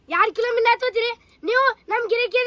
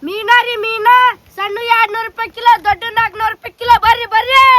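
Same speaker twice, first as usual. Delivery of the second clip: loud market hawker call, louder; vendor shout; 11 dB louder than usual. Acted. Fish seller calling passers-by in the market, loud hawking. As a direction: bellow the price out to the street.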